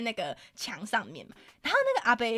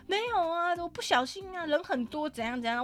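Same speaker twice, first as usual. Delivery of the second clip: mock pitiful; 6 semitones higher, 5 dB quieter than usual. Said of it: "他就开始装可怜".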